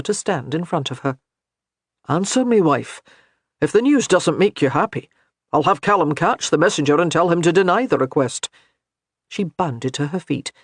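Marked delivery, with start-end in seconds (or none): 2.1-8.5 a hint of a commanding laird; firm, pressing the question; demanding husband. A narrator's reading. Gabriel demands an answer.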